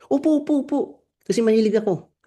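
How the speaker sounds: put-on high-pitched voice of a child; eager grandchild. Grandchild agreeing to grandfather's offer, high eager child voice. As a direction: answer grandpa as an eager little one.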